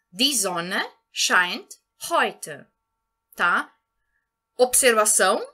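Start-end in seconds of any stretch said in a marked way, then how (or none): 0.1-2.7 German read very slowly and clearly; slow model sentence. Shown as a demonstration. Teacher shows the swapped word order, very slowly. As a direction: spell it out slowly for learners. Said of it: "Então, a frase poderia ficar".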